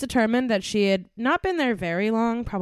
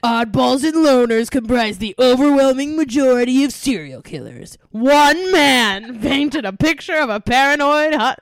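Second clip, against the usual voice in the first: loud, high, dramatic trailer narration; movie trailer voice; 5 semitones higher, 9 dB louder than usual. Pretend. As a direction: boom it like a blockbuster trailer. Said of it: "like a movie trailer or something".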